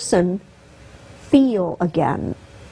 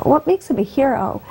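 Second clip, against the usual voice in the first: normal talking voice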